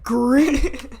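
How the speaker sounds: imitating a baffled stranger; incredulous echo. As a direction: echo it back in mock disbelief.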